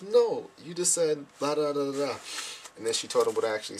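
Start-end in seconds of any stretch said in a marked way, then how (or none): 0.0-2.2 high, drawn-out retold correction; slow it down, pitched up, to correct; mock correction. Sister corrects the speaker, high mock-exasperated.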